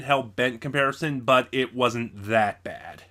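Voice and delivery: ordinary talk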